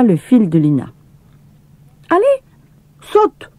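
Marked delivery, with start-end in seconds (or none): 2.1-3.5 put-on high, bossy boy's command; bossy little brother; boy giving orders. A narrator's reading. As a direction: order her to jump like an impatient boy. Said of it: "ordonna-t-il".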